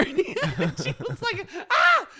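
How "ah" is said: high laughing shriek of shock; hammed-up shocked squeal; startled shriek. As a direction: squeal in mock alarm. Friend's shocked cry retold.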